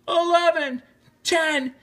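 high, urgent chanted count; crowd yelling countdown. A narrator's reading. The crowd counting down the last seconds, yelling. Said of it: "the crowd yelled".

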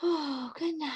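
teacher saying good night in a game; hushed good night. Pretend. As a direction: coo it quietly. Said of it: "pretend sleep game".

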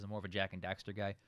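usual speaking voice

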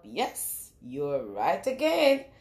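ordinary delivery

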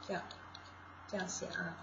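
usual speaking voice

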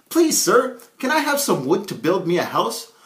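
high squeaky piglet pleading; polite little pig. Acted. Second piggy Herman politely asks the woodsman for wood, high and pleading. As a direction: ask sweetly, like a tiny pig. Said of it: "came upon a woodsman and asked".

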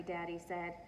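usual speaking voice

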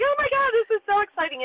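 high, fast, thrilled squeal; mock-thrilled outburst; excited gush. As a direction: gush like the family hearing the news. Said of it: "We're like".

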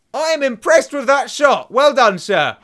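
loud, high, slow mock praise; sarcastically applaud the enemy's shot; over-the-top indignant congratulation; mock admiration.

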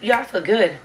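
usual speaking voice

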